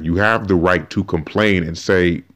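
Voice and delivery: usual speaking voice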